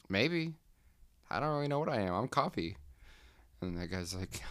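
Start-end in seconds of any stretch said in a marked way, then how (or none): 0.1-2.8 high, unsure little voice; high coffee voice. Retold or imitated. The coffee cup answers the worker in a high, unsure voice. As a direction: shrug it off in a high squeak. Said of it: "the coffee's like".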